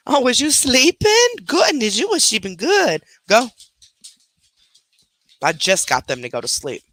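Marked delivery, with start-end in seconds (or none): 0.1-3.5 high, sing-song cooing at the pet. Real, not acted. Cooing to her pet Holly.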